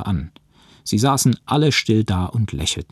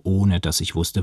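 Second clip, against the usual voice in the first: plain reading voice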